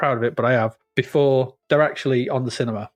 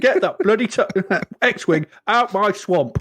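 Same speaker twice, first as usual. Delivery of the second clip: irritated, higher cockney order; angry East End Yoda; 5 semitones higher than usual. Acted. Yoda wanting the X-Wing moved, angry East Ender. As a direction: order them off like an angry geezer. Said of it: "angry sort of East End guy".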